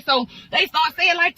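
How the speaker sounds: ordinary delivery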